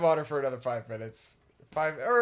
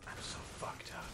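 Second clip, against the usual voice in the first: whisper; 14 dB quieter than usual. Pretend. Repeats line, whispered.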